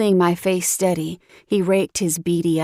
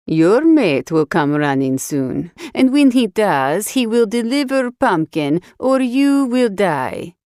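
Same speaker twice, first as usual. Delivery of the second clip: menacing Russian captor; 2 semitones lower than usual. Acted. Captor threatens in accented English.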